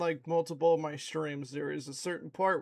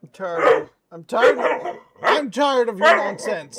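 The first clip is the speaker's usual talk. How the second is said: much louder, fed-up shout at the dog; exasperated loud scolding; 4 semitones higher, 13 dB louder, slower than usual. Real, not acted. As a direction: let the frustration burst out at full volume.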